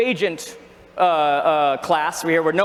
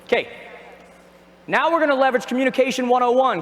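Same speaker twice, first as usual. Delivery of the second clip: ordinary talk, nothing marked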